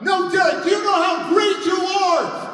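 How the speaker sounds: high-pitched, full-volume yell; screaming plea. Retold or imitated. Counselor backing up from Derek, screaming. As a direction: shout it as you back away. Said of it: "And she's screaming".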